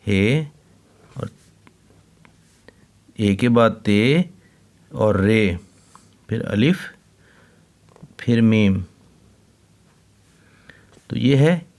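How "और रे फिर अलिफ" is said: ordinary talk, nothing marked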